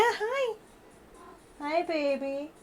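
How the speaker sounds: high, soft pet voice. Real, not acted. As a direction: coo softly to the kitty. Greeting her cat.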